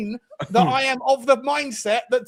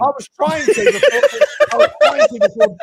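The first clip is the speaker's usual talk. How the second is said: laughing; 12 semitones higher, 8 dB louder than usual. Real, not acted. Laughing after being mocked.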